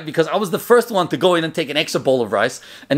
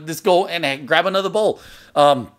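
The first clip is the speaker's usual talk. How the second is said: ordinary talk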